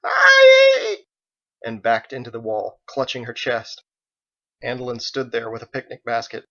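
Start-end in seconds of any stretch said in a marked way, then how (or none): 0.0-1.1 piercing high scream of fright; terrified shriek. Acted. Faith startled at the church door, terrified shriek. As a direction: shriek as if attacked. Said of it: "Faith shrieked in terror".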